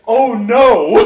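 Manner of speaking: loud, high alarmed cry; sudden loud dismay at the kicked-in door. Retold or imitated.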